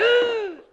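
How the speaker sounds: sharp high gasp of fright; startled gasp. Acted. Announcer gasping as a rat runs over his foot. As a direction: jolt as something hits your foot.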